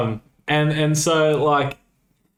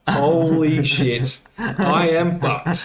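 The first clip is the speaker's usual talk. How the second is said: slow, laughing dismay; slow laughing mock panic as the mates close in; mock dread; 3 semitones higher, much slower than usual. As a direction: drag it out with a laugh. Realising the gang is coming.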